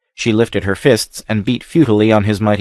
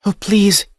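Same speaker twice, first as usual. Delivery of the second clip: begging; 11 semitones higher than usual. A narrator's reading. Captive girl begging the stranger, pleading. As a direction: beg him to keep his voice down. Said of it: "she begged".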